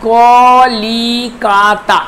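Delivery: slow, drawn-out Calcutta in four held syllables. Shown as a demonstration. Teacher counting four syllables for students, syllable by syllable. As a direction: sound out ক-লি-কা-তা one long beat at a time. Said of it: "এইখানে আছে চারটি দল আছে".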